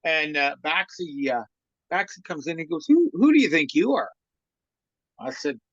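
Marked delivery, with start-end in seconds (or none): 2.9-4.1 higher and louder, indignant; indignant confrontation. Retold or imitated. Coach Baxi confronting the trainer over dinner, indignant and loud. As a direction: challenge him head-on, outraged.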